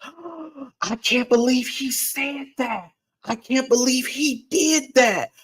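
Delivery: high, scandalized mock voice, slow and appalled; pearl-clutching churchgoers. Mocking church members shocked by his podcast, in a high appalled voice. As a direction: mimic the offended congregation, aghast and prissy. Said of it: "clutching their pearls".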